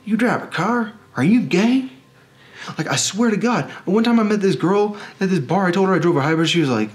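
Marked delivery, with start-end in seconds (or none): 0.1-1.9 high-pitched, disbelieving mock question; mock incredulity, much higher than usual; incredulous Texan guy. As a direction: quote a scandalized stranger, pitch leaping up. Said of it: "And this guy goes".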